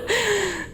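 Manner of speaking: short self-satisfied laugh; pleased little snicker; smug laugh. Acted. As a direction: chuckle at her misfortune. Laughs at sister's plight.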